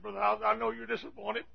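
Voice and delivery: a touch of a crying plea; imitating tearful pastor. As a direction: lightly act out a pastor in tears. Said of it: "He stood up here crying".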